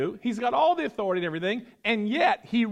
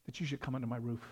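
humble plea; 6 semitones lower, 8 dB quieter than usual. Acted. Centurion's humble plea, read softly.

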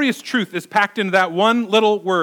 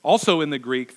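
ordinary talk

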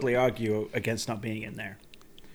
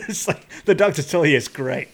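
laughing; 3 semitones higher, 8 dB louder than usual. Real, not acted. Laughing through joke.

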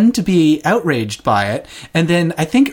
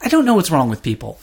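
ordinary talk

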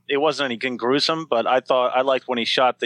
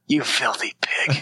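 whispered with a laugh; slower than usual. Retold or imitated. Retelling the dentist's line.

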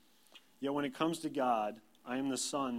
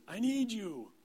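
put-on high, wailing kid call; pitched way up, drawn-out plea; child yelling for Dad; 10 semitones higher, slower than usual. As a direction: mimic a needy four-year-old calling out. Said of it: "Tate, who is four, our youngest, yells".

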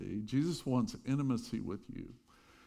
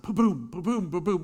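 loud, high ba-boom of the boat hitting waves; loud bouncing noise; 6 semitones higher, 7 dB louder than usual. Retold or imitated. Pastor imitating the sailboat slamming waves, loud. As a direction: mimic the hull slamming over the waves.

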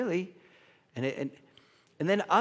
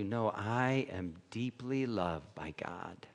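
much lower and softer, slow; quiet assurance; 6 semitones lower, 8 dB quieter, slower than usual. Retold or imitated. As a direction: say it to yourself, held close. Quiet affirmation of faith.

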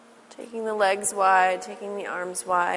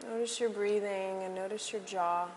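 soft spa whisper; 5 semitones higher, 10 dB quieter than usual. Acted. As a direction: lull them into stillness softly. Guided relaxation in Shavasana.

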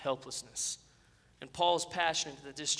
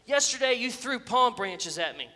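higher, much louder outraged demand; indignant shout; 5 semitones higher, 7 dB louder than usual. A narrator's reading. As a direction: shout it like a slighted celebrity. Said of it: "he shouted".

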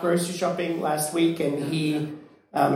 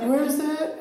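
surprised question; 9 semitones higher than usual. Retold or imitated. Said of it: "And I was like".

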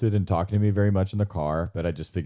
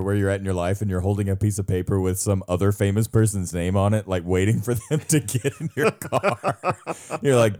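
normal talking voice

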